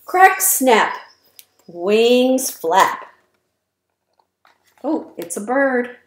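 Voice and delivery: bouncy, sing-song rhyming read-aloud; reading to children. A narrator's reading. Reading rhymes to young children, lively sing-song. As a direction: punch each rhyme for little listeners.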